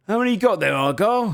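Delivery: high-pitched call to another guard. Acted. Guard hailing his fellow guard about prisoners, calling out high. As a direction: call out a question across the yard. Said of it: "puts his hand up and looks and goes".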